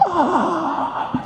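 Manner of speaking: high open-throated "aah" yell; high-pitched mock scream; demonstrated yell. Shown as a demonstration. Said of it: "así gritaba este hombre".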